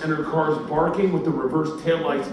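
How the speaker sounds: ordinary talk, nothing marked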